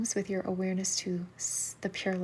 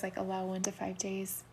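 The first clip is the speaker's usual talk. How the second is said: ordinary talk, nothing marked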